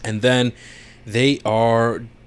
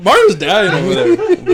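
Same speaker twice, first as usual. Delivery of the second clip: higher and louder, slow with laughter; laughing; 10 semitones higher, 7 dB louder, slower than usual. Real, not acted. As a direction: crack up at your friend. Laughing at co-host.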